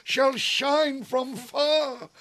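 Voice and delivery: high, slow, grandiose ranting; lofty tyrant's vein. Acted. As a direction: declaim it like a swaggering tyrant. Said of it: "was lofty".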